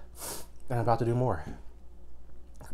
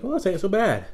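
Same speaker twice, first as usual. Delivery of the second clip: mock nonchalance; 10 semitones higher, 4 dB louder than usual. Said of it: "I was like".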